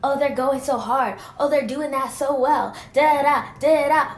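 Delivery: loud mocking cheer of praise. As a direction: imitate people fawning over others. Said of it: "everybody else gets praise".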